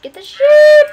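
squealed high and loud at the sheep; excited squeal. Real, not acted. Gamer squeals at the sheep in the game, excited.

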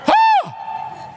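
very high, piercing "ah"; re-enacted high shriek; sudden scream. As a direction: let out one sharp imitated cry. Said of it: "And I heard".

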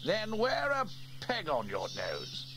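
high, slow squeal of disgust; lightly voiced boy squealing; squealing Norman. A narrator's reading. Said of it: "said Steele".

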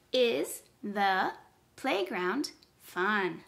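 slow, separated words. Shown as a demonstration. Teacher counts words with pupils, slow word by word. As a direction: say each word alone, with gaps. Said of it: "count how many words are in that sentence".

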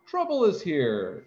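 high storybook announcement; crying out. A narrator's reading. Zounds greeting the bird at last, crying out high. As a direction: cry it out high without shouting. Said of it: "Zounds cried".

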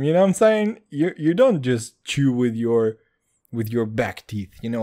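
usual speaking voice

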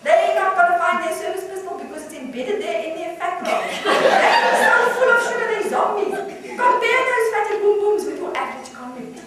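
high, loud, scornful comic rant; outraged caller holding forth, voice climbing; radio caller ranting. Acted. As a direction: rant incredulously, punching up each jibe.

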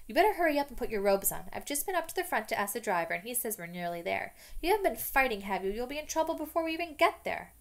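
loud, fast bossy scolding; know-it-all girl nagging the boys. Acted. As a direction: hurry them up like a prefect. Hermione bossing the boys.

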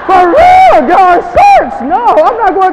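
loud, high laughing outburst; laughing shout. Real, not acted. Speaker jokes to the audience about sharks, laughing shout.

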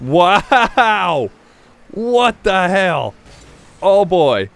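loud-ish, high, stretched-out disbelief; real high-pitched astonishment; astonished. Real, not acted. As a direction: draw it out in amazed shock. Reacting to a shocking play.

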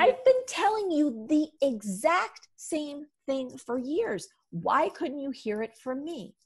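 high, slow mock exasperation; imitating husband. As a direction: drag it out, slow and put-upon. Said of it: "Josh rightfully looks at me like".

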